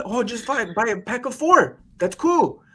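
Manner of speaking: high, reassuring sales voice; breezy telemarketer. Retold or imitated. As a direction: wave away the catch. Acting out breezy sales pitch.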